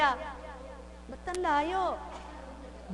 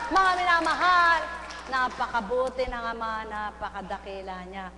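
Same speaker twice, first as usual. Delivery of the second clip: preaching; 4 semitones lower than usual. Acted. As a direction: preach it to the beloved. Preaching address. (pangangaral sa mga minamahal)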